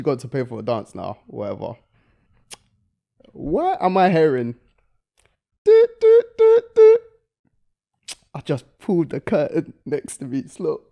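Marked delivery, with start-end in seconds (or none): 5.6-7.0 high mock beeps of a card machine; beeping noise.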